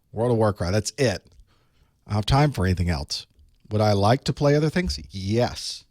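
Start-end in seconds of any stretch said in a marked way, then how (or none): none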